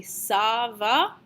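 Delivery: "Ça va?" with the voice going up at the end; question rise on "Ça va?". Shown as a demonstration. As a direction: lift "va" sharply into a question. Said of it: "your voice needs to go up at the end of the question".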